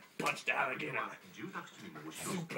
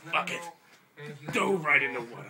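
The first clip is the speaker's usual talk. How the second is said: loud, defiant action-hero vow; hammed-up bravado; heroic loud declaration; 9 dB louder than usual.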